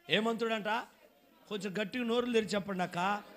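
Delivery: ordinary talk, nothing marked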